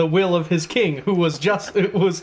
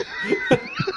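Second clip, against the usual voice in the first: squeaky burst of laughter; high laugh burst. Real, not acted. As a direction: break into a high giggle.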